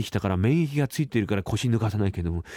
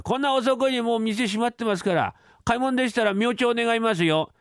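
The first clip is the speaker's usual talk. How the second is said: higher, breezy clerk brushing off a caller; a clerk shooing a late customer; cheeky clerk; 10 semitones higher than usual. Acted. Clerk tells the caller the shop is closed, high and breezy.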